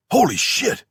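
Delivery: high, loud shocked yelp; a squawk of pure shock; startled yelp. Acted. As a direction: blurt it out in sudden fright. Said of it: "Ewing yelped".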